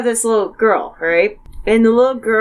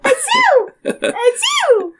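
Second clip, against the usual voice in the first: big sneeze; slower than usual. Retold or imitated. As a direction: bark out a big fake sneeze. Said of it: "mine are like".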